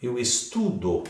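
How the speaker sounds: "estudo" said slowly, leaning on TU; stress on TU. Shown as a demonstration. Teacher pointing out the tonic syllable, stress on TU in "estudo". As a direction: land on the middle syllable of "estudo". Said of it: "a sílaba tônica está aqui".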